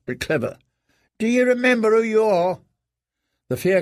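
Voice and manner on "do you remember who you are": put-on boy's voice. A narrator's reading. Clever boy questioning prisoner.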